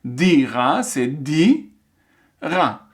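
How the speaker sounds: slow "dira" with a long "di", then "ra" on its own; "dira" sounded out slowly as "di" and "ra"; syllable by syllable. Shown as a demonstration. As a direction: stretch "di" so the vowel is heard, pause, then "ra". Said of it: "à l'écoute, quand on entend".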